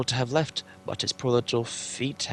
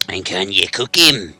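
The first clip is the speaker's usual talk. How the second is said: loud, slow coarse troll voice; troll Tom; 11 dB louder, slower than usual. Acted. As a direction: ask it like a hungry troll.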